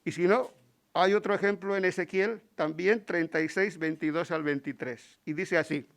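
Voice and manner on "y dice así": usual speaking voice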